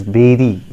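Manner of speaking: "berry" as BEH-ree, falling; correct pronunciation. Shown as a demonstration. Teacher giving learners the correct form, "berry" as BEH-ree. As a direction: say "berry" with an eh vowel. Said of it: "the correct pronunciation is".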